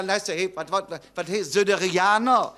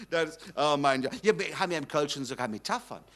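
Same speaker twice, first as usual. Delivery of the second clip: ordinary delivery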